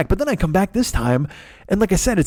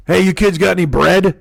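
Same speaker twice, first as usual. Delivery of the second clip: put-on mom's boyfriend; 2 semitones higher, 3 dB louder than usual. Voicing mom's boyfriend in kitchen.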